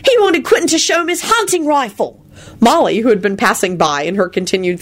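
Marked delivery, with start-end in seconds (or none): 0.1-2.2 put-on high, insistent boy's voice; stubborn holler. A narrator's reading. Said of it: "hollered stubbornly".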